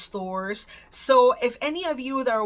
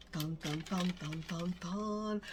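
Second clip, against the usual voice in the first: soft, low sung drumroll for suspense; mock drumroll; 3 semitones lower, 10 dB quieter, slower than usual. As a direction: hum a quiet drumroll to build suspense. Drumroll before revealing price.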